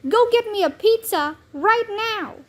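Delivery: mock yell of a spoiled kid ordering mom around; mild retold yell. A narrator's reading. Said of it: "He yells".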